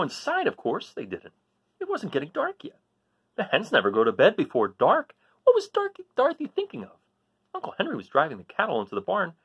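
put-on animated storyteller voice for a children's book; lively story reading. A narrator's reading. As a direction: read it with wide swings in pitch, bright and eager. Reads Toto's story aloud.

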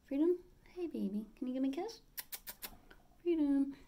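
high coaxing sing-song for the parrot; asking her parrot for a kiss in a high pet voice. Real, not acted. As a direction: coax the bird up high and sweet.

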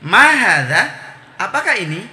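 loud "Ma hadza" swept up into a question, then "apakah ini"; question intonation. Shown as a demonstration. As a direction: ask "Ma hadza, apakah ini" with exaggerated melody. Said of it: "ada intonasinya".

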